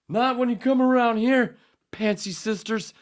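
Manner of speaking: very high, loud cartoon snarl; character voice. Host bursts into a loud high cartoon voice. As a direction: blast it out as a shrill villain.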